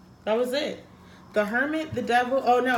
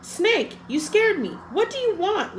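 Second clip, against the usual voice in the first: high, louder startled lizard; startled cry; 10 semitones higher, 4 dB louder than usual. Acted. Lizard cries out, startled by Snake, high and loud. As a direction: jump out of your skin as Lizard. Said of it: "Lizard cried".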